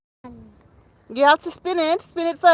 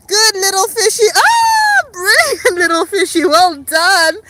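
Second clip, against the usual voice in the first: high, loud delighted praise with a laugh; thrilled mummy praise; excited praise; 6 semitones higher, 4 dB louder than usual. Real, not acted. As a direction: cheer the little one on. Praising toddler's fish act.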